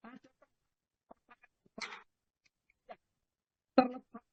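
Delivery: choppy call audio dropping out; bad connection, words breaking up; voice cutting out. Real, not acted. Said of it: "Suaranya putus, Ustaz".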